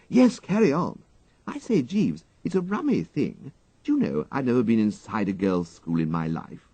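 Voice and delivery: put-on a curious young toff chatting to his valet; Wooster. Wooster chats with Jeeves.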